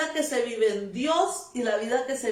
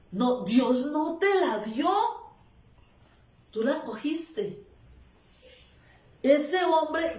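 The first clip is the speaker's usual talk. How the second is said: normal talking voice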